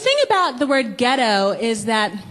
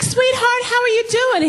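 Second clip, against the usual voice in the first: high, sugary hello; sweet greeting; 10 semitones higher than usual. Retold or imitated. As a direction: coo it to him sweetly. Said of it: "I was like".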